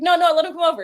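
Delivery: insistent. Retold or imitated. Todd overruling staff turning a kid away, insistent. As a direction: wave off the objection and insist. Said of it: "it was like".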